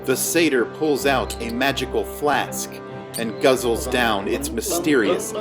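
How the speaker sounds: ordinary delivery, nothing marked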